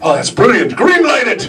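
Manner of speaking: high, gushing mock approval; gushing executive. Executives approving the nose hair show, mock gushing. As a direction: rave about it like a smug studio boss. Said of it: "And they go".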